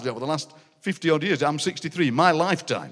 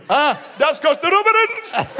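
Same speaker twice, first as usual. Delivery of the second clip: very high, loud slow punchline yell; joker uncle shouting; 11 semitones higher, 4 dB louder, slower than usual. Retold or imitated. Uncle shouting his punchline at dad, very high. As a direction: bellow the old joke proudly.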